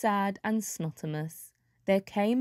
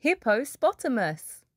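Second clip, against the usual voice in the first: plain reading voice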